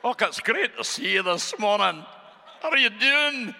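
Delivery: high-pitched mock Scottish greeting; Scottish accent. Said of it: "I was doing the Scottish one".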